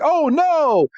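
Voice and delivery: high-pitched comic alarm; mock dismay. Villains react to being spotted.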